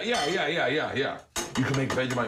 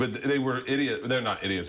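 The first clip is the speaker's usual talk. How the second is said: usual speaking voice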